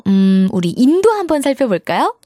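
normal talking voice

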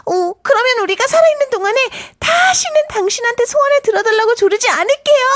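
fast, eager rush of words; hurried promise. Acted. Anthea promising the sand fairy, fast and eager. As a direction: blurt the promise out quickly.

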